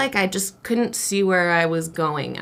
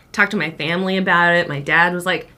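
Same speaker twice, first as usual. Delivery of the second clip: ordinary talk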